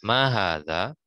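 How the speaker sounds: Mahadza with the a in ma, ha and za each held long; long vowels on ma, ha, za. Shown as a demonstration. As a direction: draw out every long a in Mahadza. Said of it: "Dia panjang di ma, panjang di ha, panjang di za".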